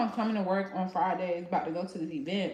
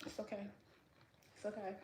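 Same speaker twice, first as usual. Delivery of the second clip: much softer, resigned it's okay; soft self-reassurance; 3 semitones higher, 16 dB quieter, faster than usual. Real, not acted. Consoling herself about the unpainted bathroom, softly. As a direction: comfort yourself under your breath. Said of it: "resigned self-reassurance".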